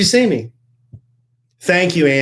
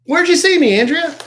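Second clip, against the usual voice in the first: high playful call; 12 semitones higher, 4 dB louder than usual. Acted. Playful greeting call to listener.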